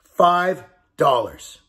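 "Five dollars" drawn out very slowly, both words weighted; slow the ask right down, word by word; a slow, pleading repeat of the amount; slow plea. Shown as a demonstration. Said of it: "I just want you to donate five dollars".

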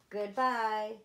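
plain reading voice

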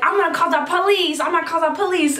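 high-pitched, agitated mock threat; imitated irate man. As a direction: mimic a worked-up man making threats.

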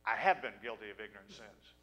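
normal talking voice

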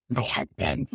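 deep croaky post-tube voice; sounding like a man. Retold or imitated. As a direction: croak it out in a voice like a man's. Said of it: "I sound like a man".

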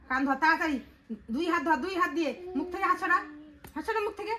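real exasperated telling-off; scolding orders. Real, not acted. Mother orders child to hold ears.

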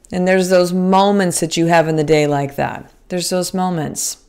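usual speaking voice

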